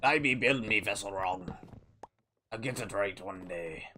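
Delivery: lightly voiced pirate talk; pirate captain. Pirate streamer narrating his boat build, lightly voiced pirate. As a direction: play a grumbling-along pirate builder.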